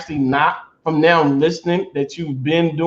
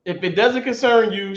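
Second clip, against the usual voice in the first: usual speaking voice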